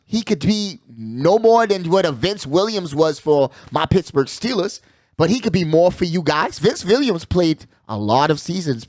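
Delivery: usual speaking voice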